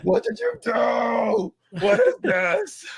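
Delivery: high, drawn-out distressed wailing; mock panic. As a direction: wail the questions in comic horror. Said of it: "and then he's like".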